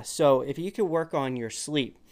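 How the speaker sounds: ordinary talk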